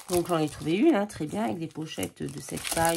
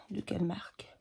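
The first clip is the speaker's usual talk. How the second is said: whispering; 6 dB quieter than usual. Real, not acted. Asks herself aside.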